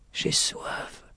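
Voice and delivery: faint, breath-only voice; thirsty whisper. A narrator's reading. Injured lady repeating her thirst, faint whisper. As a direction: whisper it weakly. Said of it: "Il avait soif aussi".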